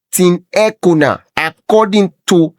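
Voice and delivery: ordinary talk, nothing marked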